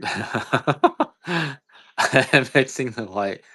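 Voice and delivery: high laugh breaking into the words. Real, not acted. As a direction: start talking while still laughing. Laughs after his own joke.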